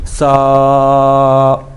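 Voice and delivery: سا held long on one even note; whistling s, vowel of سا drawn out; stretched hard s. Shown as a demonstration. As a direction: stretch سا steadily. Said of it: "ایک الف کے برابر اس کو ہم نے کھینچنا ہے".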